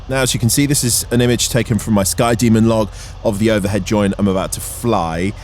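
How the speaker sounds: ordinary talk, nothing marked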